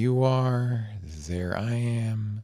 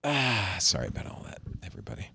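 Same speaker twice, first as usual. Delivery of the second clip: usual speaking voice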